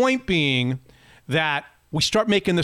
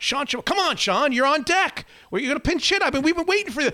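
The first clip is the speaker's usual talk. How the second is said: urging; 8 semitones higher than usual. Retold or imitated. Urging the stranger to bat.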